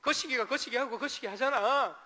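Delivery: fumbling Jeolla speaker groping for a word; Jeolla dialect. Retold or imitated. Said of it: "전라도 분들 이제".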